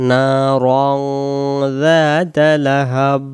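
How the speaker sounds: "নারং" with a long naa and humming ng; slow "নারং যাতা লাহাব", nasal sound held; nasalised recitation. Shown as a demonstration. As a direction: hold the nasal end of "নারং" before "যাতা". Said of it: "এটা রং বলতে হবে".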